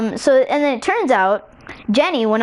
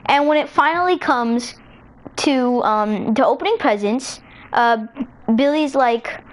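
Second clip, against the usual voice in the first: ordinary delivery, nothing marked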